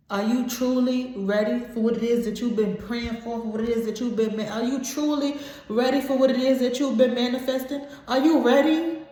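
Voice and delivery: rising from earnest urging to a high, loud cry; building fervor. Real, not acted. Reader rallying viewers before God's blessing, building to a loud cry. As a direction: drive it up until it bursts.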